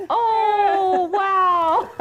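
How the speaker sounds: high, loud drawn-out cry; hammed-up wail of a startled costume wearer; mock alarm. Acts out wearer as mask head moves.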